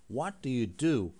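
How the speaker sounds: slow, careful question. Shown as a demonstration. Teacher slowing a question down for students. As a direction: say it slowly for learners. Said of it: "Yavaş yavaş söyleyin".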